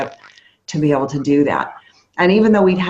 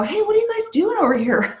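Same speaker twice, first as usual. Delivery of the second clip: curious stranger; 14 semitones higher than usual. Retold or imitated.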